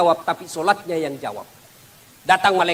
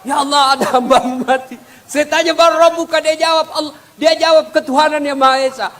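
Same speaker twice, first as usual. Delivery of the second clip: high, loud angel report; 9 semitones higher, 4 dB louder than usual. Acted. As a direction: tell God the story breathlessly. (malaikat melapor kepada Allah dengan suara tinggi)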